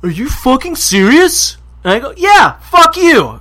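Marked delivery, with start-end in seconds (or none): 0.0-1.6 high, loud, drawn-out disbelief; outraged retort. Retold or imitated. Other man answers in quarrel. 2.2-3.4 very high, loud, drawn-out yell; furious retort. Retold or imitated. Acted retort in quarrel.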